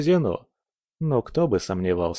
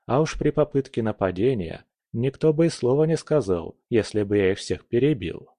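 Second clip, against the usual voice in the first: ordinary delivery, nothing marked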